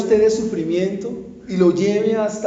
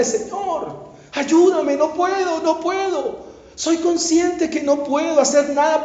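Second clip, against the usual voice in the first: higher, pleading cry to God; desperate plea; 6 semitones higher, slower than usual. Retold or imitated. Pastor voicing a sinner crying to God at the cross, higher and pleading. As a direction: beg for help, voice raised and straining. Prayer at the cross, arms open.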